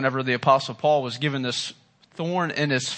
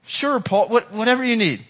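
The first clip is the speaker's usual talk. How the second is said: mock easygoing God; 6 semitones higher than usual.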